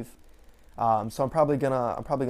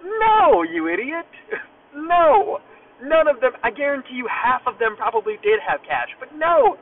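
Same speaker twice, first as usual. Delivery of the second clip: much higher and louder, laughing in disbelief; exasperated shout; 8 semitones higher, 7 dB louder than usual. Real, not acted. Venting to listeners about the cashier, loud laughing exasperation. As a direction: blow up at the sheer stupidity. Ranting about customers with cash.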